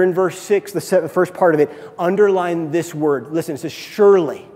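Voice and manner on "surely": loud preached scripture; forceful scripture reading; preaching loud. Acted. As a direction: proclaim the verse to the room.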